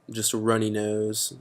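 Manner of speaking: normal talking voice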